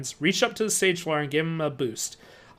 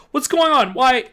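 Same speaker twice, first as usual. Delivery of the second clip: frightened; 8 semitones higher, 7 dB louder than usual. A narrator's reading. Frightened questions to friend.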